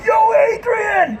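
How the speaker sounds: high, drawn-out call; mock crowd yell. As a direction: call it out high and long like a heckling fan. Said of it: "he talks like Sylvester Stallone. And people going".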